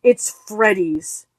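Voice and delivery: slow, heavy and final; a mother's slow, grim verdict. Acted. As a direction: let each word drop slowly. Wife's grim statement.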